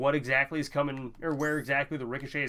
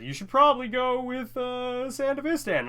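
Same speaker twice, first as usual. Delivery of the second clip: high, louder put-on voice; imitating Goose; 10 semitones higher, 4 dB louder than usual. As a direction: quote him in a raised, high-pitched voice. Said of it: "He said".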